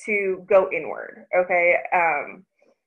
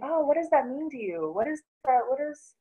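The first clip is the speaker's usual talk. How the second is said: higher, gently questioning mom voice; gentle curious mom; 3 semitones higher, 3 dB quieter than usual. Retold or imitated. Mom gently asking her daughter what words mean, higher and curious. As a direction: ask softly, really curious. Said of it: "I've been saying".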